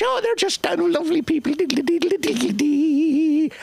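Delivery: high, hammed-up jolly Irishman; mock lovely Irish. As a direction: gush it up high, then diddle the jig. Mock cheerful Irish stereotype.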